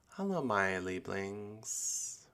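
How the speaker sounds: slow, soft hello my Lieblings; trying out a slow tender hello; slow greeting. Pretend. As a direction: stretch it out softly. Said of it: "What do we want to call you?".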